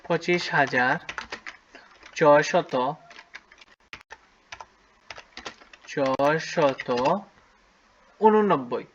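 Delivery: slow, drawn-out dictation with long pauses; dictating slowly. Shown as a demonstration. Teacher dictating to pupils, slow with long pauses. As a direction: spell out each part of the number slowly for the pupils. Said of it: "লিখে ফেলি".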